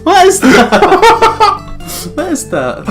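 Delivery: ordinary delivery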